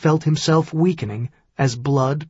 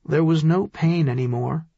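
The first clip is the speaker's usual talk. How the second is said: ordinary delivery, nothing marked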